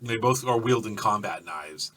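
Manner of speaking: ordinary talk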